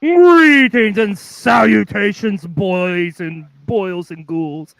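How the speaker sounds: slow, booming spooky host greeting; jack o lantern voice. Acted. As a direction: welcome them like a Halloween ghoul host.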